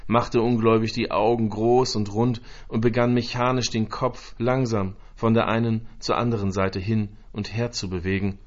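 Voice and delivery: ordinary delivery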